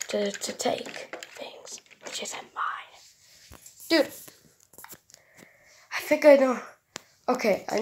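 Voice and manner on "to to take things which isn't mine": slow whispered robot voice. Acted. Robot explains its stealing.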